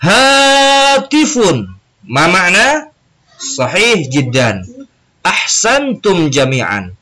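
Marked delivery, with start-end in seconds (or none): 0.0-1.7 "Hatifun" louder and higher, slow and drawn out; louder repetition. Shown as a demonstration. Teacher urges students to repeat "Hatifun" louder. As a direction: call out "Hatifun" with more voice. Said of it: "lebih keras suaranya".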